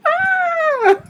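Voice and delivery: very high imitated shriek; imitating an actor's high-pitched scream; girlish scream. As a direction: shriek like a frightened girl. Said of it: "He screams like a girl".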